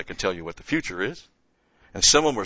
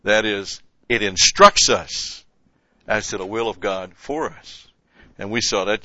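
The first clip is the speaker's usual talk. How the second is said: normal talking voice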